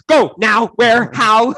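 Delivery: loud, urgent imitation of a flustered husband; panicked husband. Husband seeing the cut hand, loud panicked questions. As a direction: fire off each question in a rush of panic.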